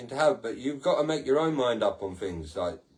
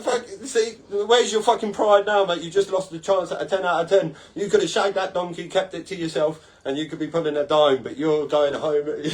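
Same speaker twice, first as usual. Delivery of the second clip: mocking taunt; 4 semitones higher, 3 dB louder than usual. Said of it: "and you'd be like".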